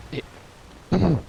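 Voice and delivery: slow, voice catching and failing; losing voice. Real, not acted. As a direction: voice giving out mid-sentence. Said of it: "Sorry, I lost my voice there for a minute".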